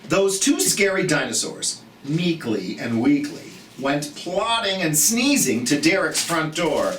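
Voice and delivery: lively, sing-song picture-book reading; reading to children. A narrator's reading. Teacher reading a storybook aloud to children, lively and sing-song. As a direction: read it brightly to a group of little kids.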